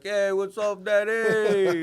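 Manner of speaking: put-on high, loud, cocky kid voice like the Fonz; cool little kid. Dad imitating his cool toddler son, high and cocky. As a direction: play the son as a tiny Fonz. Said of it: "you think he was the Fonz".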